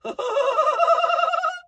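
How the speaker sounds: high shouted mock laugh; imitating a singer's absurd laugh; ridiculous mock laugh. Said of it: "laughs a ridiculous".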